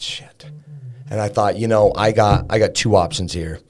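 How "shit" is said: barely audible shit; hushed unvoiced curse; whispered curse. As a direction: breathe it out in defeat. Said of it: "I just said".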